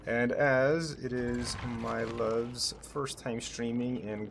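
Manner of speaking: slow, soft, drawn-out announcement. Real, not acted. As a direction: spell it out slowly and softly. Announcing the raid slowly.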